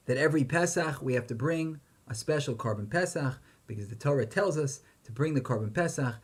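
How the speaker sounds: ordinary talk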